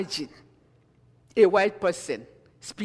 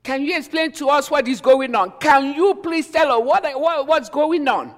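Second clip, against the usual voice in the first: loud, high and hurried pleading; confused crowd; 7 semitones higher, 6 dB louder than usual. Acted. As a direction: demand answers, bewildered and pressing. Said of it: "Some of them were like".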